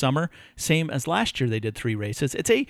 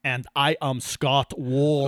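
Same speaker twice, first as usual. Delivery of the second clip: slow, hammed-up German accent; German accent, deliberately terrible; mock German accent; 4 dB louder, slower than usual. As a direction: put on a bad German accent for the intro. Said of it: "you sound like you have a bit of an accent there".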